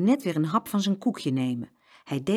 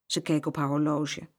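ordinary delivery, nothing marked

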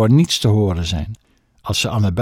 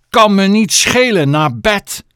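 loud, higher angry order; angry shout; 9 semitones higher, 6 dB louder than usual. Acted. Mother overrules Chloe's protest, shouting. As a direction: cut the protest off hard.

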